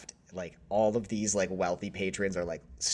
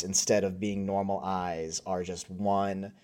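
usual speaking voice

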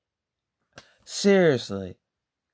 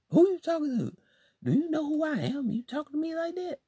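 indignant quote; 11 semitones higher, 5 dB quieter, faster than usual. Retold or imitated. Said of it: "And I'm like".

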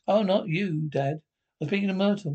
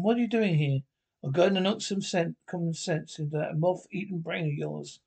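ordinary talk, nothing marked

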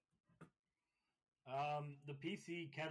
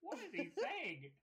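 bad British accent; 11 semitones higher, slower than usual. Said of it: "I don't do accents well".

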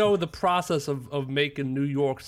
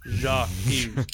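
put-on deliberate French in a broad American accent; slower than usual. American addressing the court, French words in American accent. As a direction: sound out French as an American would. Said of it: "French words in an American accent".

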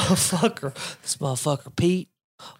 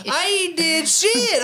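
mock defensive; 8 semitones higher, slower than usual.

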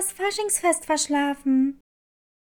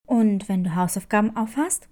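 lower, calm nurse asking gently; school nurse voice; 8 semitones lower than usual. Acted. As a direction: drop low and ask like a kind school nurse. Nurse questions Clara.

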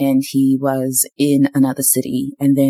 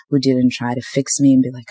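ordinary talk

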